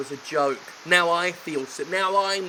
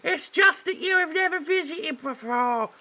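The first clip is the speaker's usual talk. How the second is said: maid voice; 13 semitones higher than usual. Acted. Maid calming the butler, falsetto. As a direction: chirp it out sweetly. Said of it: "I will for her".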